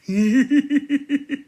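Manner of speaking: loud high-pitched cackle; high cackling laugh. Real, not acted. Vlogger cackling loud after calling himself crazy. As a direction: let out a shrill crazy cackle.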